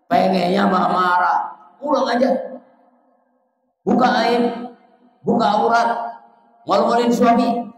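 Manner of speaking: husband's rant about his wife, voiced in a mock furious tone; angry husband's urge. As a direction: act out the husband's hot temper. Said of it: "Pengennya kan begitu".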